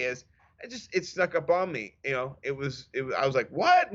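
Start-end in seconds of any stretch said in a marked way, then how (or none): 3.5-3.9 high, loud startled what; shocked what. Retold or imitated. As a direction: blurt it out in shock. Shocked retold reaction.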